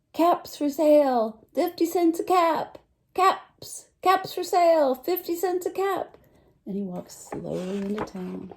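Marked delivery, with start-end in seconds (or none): 0.1-6.2 higher, louder street-seller call; peddler calling. Acted. Peddler walking into town selling his caps, a loud sing-song street call. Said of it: "walked back into town calling".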